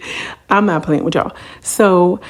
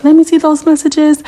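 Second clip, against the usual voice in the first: demanding; 10 semitones higher, 7 dB louder than usual. Retold or imitated. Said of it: "So Yandy's like".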